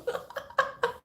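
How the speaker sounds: breathy high squeal of laughter; a silent high giggle; helpless airy laugh. Real, not acted. Laughing at own remark.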